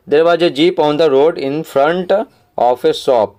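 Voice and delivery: very slow, every word spaced out for the class; slow read-aloud. Shown as a demonstration. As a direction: read the line slowly so pupils can follow. Teacher rereads passage slowly.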